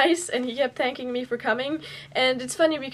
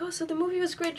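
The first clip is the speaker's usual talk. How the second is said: higher, softer acted-out fan voice; retold gushing compliment; 6 semitones higher, 5 dB quieter, faster than usual. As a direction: replay a shy parting compliment up high. Retold parting compliment to actress.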